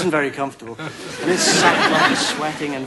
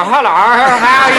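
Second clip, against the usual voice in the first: higher and louder, a put-on posh caller; caller's funny accent; 8 semitones higher, 12 dB louder than usual. Phone-in caller greeting the radio host in a funny put-on voice. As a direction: greet the DJ like a comic turn. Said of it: "you know, doing funny accents".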